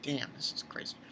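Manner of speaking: whispered in amazement; awed whisper. Retold or imitated. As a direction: breathe it out, stunned. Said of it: "I'm like".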